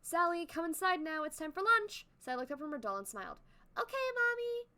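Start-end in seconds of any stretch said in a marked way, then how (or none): 0.0-2.1 lightly voiced mother calling. Mother calling her daughter inside for lunch, lightly voiced. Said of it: "calling out". 3.8-4.7 put-on high, small child's reply; little girl voice. Sally answering her mother in a high little-girl voice.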